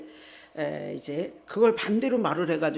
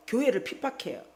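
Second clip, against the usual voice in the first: ordinary delivery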